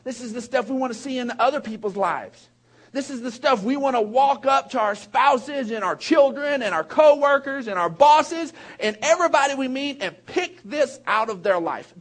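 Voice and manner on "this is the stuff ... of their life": louder, driving it home; preaching shout. Real, not acted. Pastor urging the congregation, louder. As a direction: rise up and proclaim. Building exhortation.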